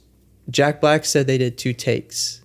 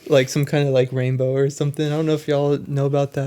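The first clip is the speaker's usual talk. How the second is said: ordinary talk, nothing marked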